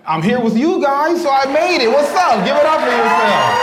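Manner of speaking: comic to crowd, cheering them on, high and loud; hype-man cheer. Real, not acted. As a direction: pump up the audience. Hyping the crowd.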